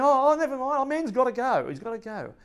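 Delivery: high, loud, hurried excuse; mock flustered Bill. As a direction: brush it off like a flustered mate who can't wait. Bill excusing himself, retold.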